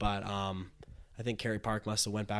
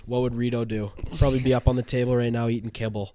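loud, fast hype-man rant; frat bro; 6 dB louder than usual. Real, not acted. Hyping up the boys, rising.

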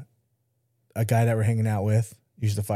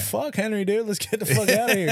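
high, urgent "let's go" plea; a mock panic to get out; panicked urging; 10 semitones higher than usual. Urging his cousin to leave the party, high and panicked. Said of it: "I'm like".

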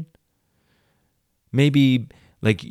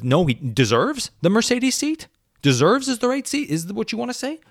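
sarcastic mock question; 11 semitones higher than usual. Real, not acted. Mocking her fumbled phrasing.

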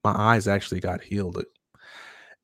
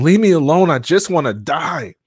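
high, loud desperate cry; half-acted anguished yell; retold scream; 9 semitones higher, 8 dB louder than usual. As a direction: scream it out in despair. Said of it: "I just kept screaming".

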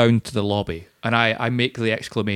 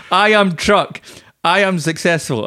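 loud, higher grand declaration; mock heroic boast; 6 semitones higher, 3 dB louder, slower than usual. Hero on his truck addressing gremlins, mock heroic. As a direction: proclaim it from the truck roof. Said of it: "and I say".

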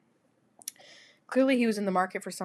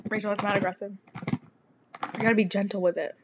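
ordinary talk, nothing marked

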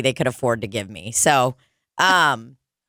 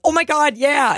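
high-pitched eager yes; mock squeal of delight, pitched up; imitated thrilled fans; 9 semitones higher than usual. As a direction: gush like an excited fan. Imitating fans offered a picture.

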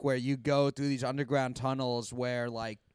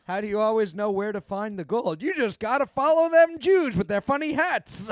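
put-on old timer voice; 6 semitones higher, 5 dB louder than usual. Joins the prospector bit.

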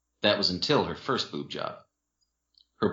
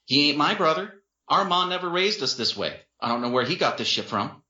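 angry; 6 semitones higher than usual. Acted. Jen disowning brother.

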